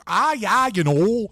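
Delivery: exaggerated North Dakota film-character accent. Streamer imitating a cop film where everyone's from North Dakota, hammed-up accent. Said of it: "where everyone's from North".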